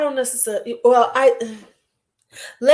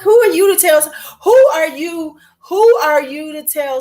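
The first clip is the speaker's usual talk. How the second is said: higher and louder, indignant; fed-up, raised challenge; outraged; 7 semitones higher, 5 dB louder than usual. Real, not acted. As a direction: rise up in disbelief. Outraged at advice givers.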